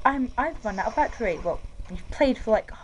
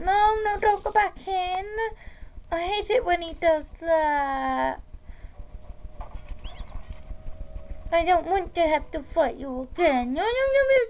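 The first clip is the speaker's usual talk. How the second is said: much higher, whiny pleading no's; high upset voice; 8 semitones higher than usual. Real, not acted. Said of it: "Why am I talking like that?".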